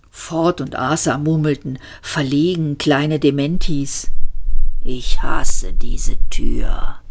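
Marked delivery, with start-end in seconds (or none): 4.8-7.0 slow, low, breathy robot grumble; gloomy robot whisper. A narrator's reading. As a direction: mutter it like a depressed robot. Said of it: "fuhr Marvin fort".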